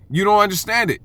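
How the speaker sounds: ordinary delivery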